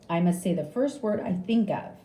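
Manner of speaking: usual speaking voice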